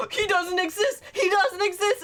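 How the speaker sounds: high, tearful panic; panicked inner voice. Retold or imitated.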